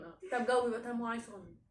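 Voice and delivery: usual speaking voice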